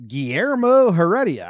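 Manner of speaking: showy, exaggerated name call; Bob Uecker. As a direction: roll out the name like a broadcaster. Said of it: "I'd love to hear Bob Uecker, like, overemphasize".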